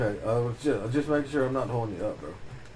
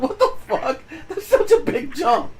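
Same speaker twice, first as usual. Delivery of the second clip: much higher, louder laughing disbelief; incredulous outburst; 5 dB louder than usual. Retold or imitated. Telling a friend about the huge ask, incredulous. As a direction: shriek it in amused shock. Retold reaction.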